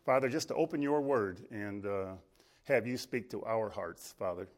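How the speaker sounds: soft, low congregational prayer. Real, not acted. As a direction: pray quietly and earnestly to the Father. Opens in prayer.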